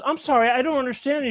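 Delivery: high-pitched apologetic confusion; puzzled old lady. Acted. Old lady failing to understand the boy, puzzled. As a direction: pitch it up, politely baffled.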